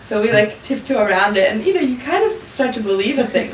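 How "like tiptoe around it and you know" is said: normal talking voice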